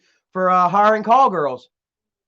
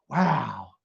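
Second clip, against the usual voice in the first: normal talking voice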